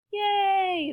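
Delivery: high, long yay; cheering. A narrator's reading. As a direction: cheer as a happy crowd. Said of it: "the crowd cheered".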